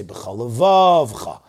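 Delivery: levavcha slowly, second V closing the syllable; silent shva in levavcha. Shown as a demonstration. Teacher giving the right reading, silent shva in levavcha. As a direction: hold the V, then cha, in levavcha. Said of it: "The shva is a silent shva".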